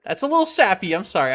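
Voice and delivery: usual speaking voice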